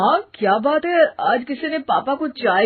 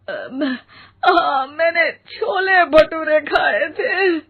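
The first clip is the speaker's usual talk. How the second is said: pained moan; 5 semitones higher, slower than usual. Acted. Mother groaning with stomach ache.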